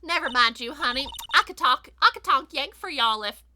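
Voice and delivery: loud, folksy Southern twang; generic Southern accent. Acted. Photographer offering to talk Yank, in a Southern accent. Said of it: "doing a very generic Southern accent".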